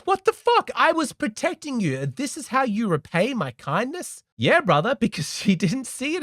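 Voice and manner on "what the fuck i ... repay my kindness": outraged disbelief. Retold or imitated. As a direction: cry out as the man who feels stabbed in the back. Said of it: "And he thinks".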